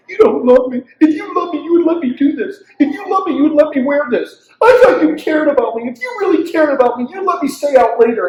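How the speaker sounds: a hint of a sulky teen pleading; whiny kid. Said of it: "the lie that the kid".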